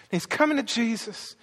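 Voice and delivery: ordinary talk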